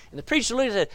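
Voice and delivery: normal talking voice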